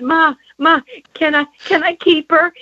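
put-on high, eager child begging; little girl asking her mother to keep the dog, high and pleading. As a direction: beg your mother like a hopeful little girl.

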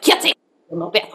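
put-on fast, stern scolding in a Russian accent; aggressive Russian accent. Owner scolding her kitten, in a quick aggressive Russian accent. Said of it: "in an aggressive Russian accent".

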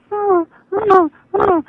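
high-pitched mock whimpers, far above normal voice; imitated dog crying. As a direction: imitate a little dog crying after its owner. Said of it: "where she starts crying".

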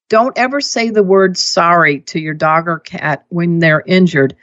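ordinary delivery

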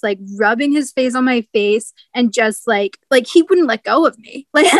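ordinary talk, nothing marked

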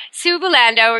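ordinary talk, nothing marked

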